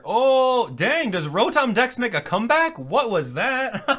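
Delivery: high, loud astonished outburst; amazed discovery. Real, not acted. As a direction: blurt it out as you spot a surprise. Spots a surprise in the trailer.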